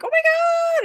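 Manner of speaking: very high, loud squeal; mock excited shriek. Imitating all the Americans discovering Scottish people, shrieking. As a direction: shriek it in hammed-up amazement.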